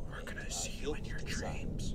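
eerie voiceless threat; shadow people whisper. Acted. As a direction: whisper it as a phantom at bedtime. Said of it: "they're always telling me".

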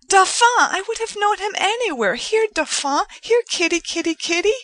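high, loud eager calling; young woman calling a cat to her. A narrator's reading. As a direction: call the kitty to come.

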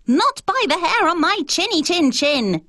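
high, squeaky piggy voice; squeaky little pig voice. A narrator's reading. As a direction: squeal it like a tiny scared pig. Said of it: "he replied".

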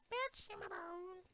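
very high, slow silly squeal. Pretend. Gamer riffing to himself, very high drawn-out squeal. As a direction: stretch it out way up high. Singsong riff ends in squeal.

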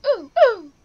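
two high, loud, short oofs; mimicked game "oof". Player echoing deaths in the game, loud mimicked oofs. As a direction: punch out quick high death noises.

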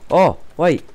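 sudden loud high yelp; startled. Real, not acted. Gamer startled by an opponent, loud yelp. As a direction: jump at the surprise.

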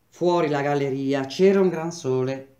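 usual speaking voice

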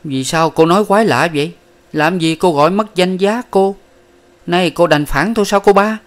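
he questions her, wounded; frowning question. A narrator's reading. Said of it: "chau mày hỏi rằng".

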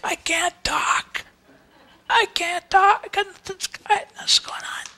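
high, strained voice fading to a whisper; strained croak sinking to a whisper; voice gone. Retold or imitated. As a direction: croak it out like a man who has lost his voice. Protester losing his voice.

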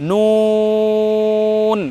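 নুন with a long held oo, lips rounded; rounded-lip noon. Shown as a demonstration. Teacher demonstrates the letter noon, long rounded নুন. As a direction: stretch নুন slowly into a final n. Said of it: "নুন উচ্চারণ করার সময় ঠোঁট গোল হবে".

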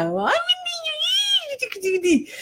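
very high, slow, soft coo; baby talk to a puppy. Retold or imitated. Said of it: "porque ya decía". (voz de bebé, aguda y lenta, mimando al cachorro)

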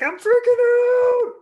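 very high, loud, slow panic scream; freaking out. Shown as a demonstration. Coach demos exploring a freak-out, screaming loud. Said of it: "exploration of, like".

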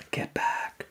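urgent hushed whisper; soft, voiceless whisper; whispered warning. A narrator's reading. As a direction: whisper a warning to get away. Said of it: "whispered Jack".

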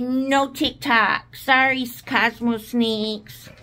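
slow, firm mock refusal; imitating Hannah's refusal. Quoting Hannah turning down the snake, slow and firm. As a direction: draw out each word like a stern little girl. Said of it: "She said".

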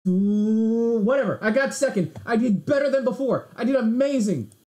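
high, loud, exasperated outburst; real high-pitched gamer frustration; frustrated protest. Real, not acted. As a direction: cry out in protest at losing, then insist loudly. Just lost, protests the result.

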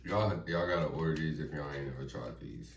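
ordinary talk, nothing marked

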